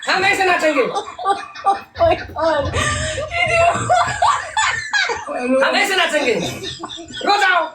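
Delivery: high laughing taunt, tossing it at her over and over; cheeky singsong jeering; gleeful taunt. Real, not acted. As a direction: tease her to go ahead and cry, chuckling. Teasing her to go cry.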